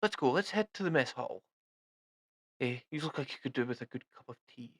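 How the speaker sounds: lightly voiced jolly British officer; hearty British army invitation; a hint of a British captain. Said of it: "he's like".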